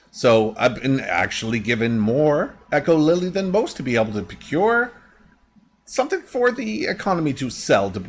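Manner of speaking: lightly voiced crafter, pitch swooping; chatty shop lady. Shop lady explains her recipe, lightly acted voice.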